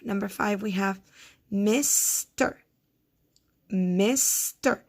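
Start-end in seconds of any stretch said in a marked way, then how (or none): 1.5-2.6 "mister" as "misss ... ter"; mister, slowly broken in two; split into syllables. Shown as a demonstration. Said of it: "vowel sounds do you hear". 3.7-4.8 "mister" as "misss ... ter"; mister, slowly broken in two; split into syllables. Shown as a demonstration. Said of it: "vowel sounds do you hear".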